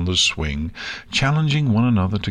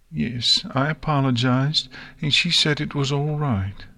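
higher small filly voice; Nix, subdued; 6 semitones higher than usual. Acted. Filly answering her friends, subdued. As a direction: downcast little filly, pitched up.

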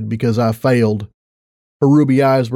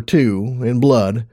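plain reading voice

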